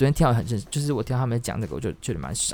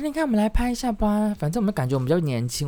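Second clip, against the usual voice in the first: mock girly voice; 8 semitones higher than usual. Mocking the girls' selfie talk.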